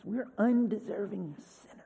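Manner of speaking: breathy hushed confession; whisper. Real, not acted. Preacher confessing to the congregation in a whisper.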